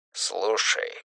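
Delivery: very soft, subdued command; quiet. A narrator's reading. Рук giving instructions by phone, quiet. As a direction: drop right down to a soft order. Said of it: "тихо сказал Рук".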